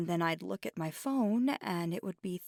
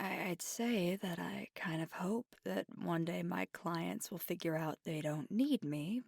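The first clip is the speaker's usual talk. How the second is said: usual speaking voice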